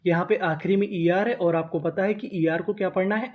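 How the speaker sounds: ordinary talk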